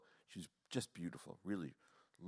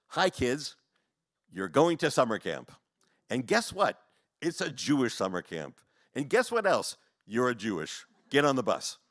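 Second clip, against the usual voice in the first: louder, punchy mock announcement to the kids; parent addressing kids; 3 semitones higher, 9 dB louder than usual. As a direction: lay it out in loud, separate phrases. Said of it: "to say to your kids the following lines".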